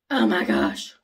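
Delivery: loud breathy gasp of awe; breathless, loud oh my gosh; awed gasp. Real, not acted. Awed by the lipstick.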